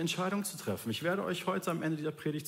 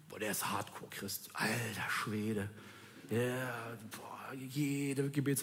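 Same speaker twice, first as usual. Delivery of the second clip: hushed, impressed whisper; whispered amazement, drawn out; awed whisper; 6 semitones lower, 7 dB quieter, slower than usual. Retold or imitated. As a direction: breathe it out in slow awe.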